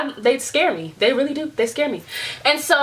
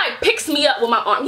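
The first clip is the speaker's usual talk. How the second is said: normal talking voice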